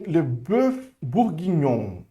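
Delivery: le bœuf bourguignon slowly in French; slow French demo of le bœuf bourguignon; French model. Shown as a demonstration. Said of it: "Repete comigo".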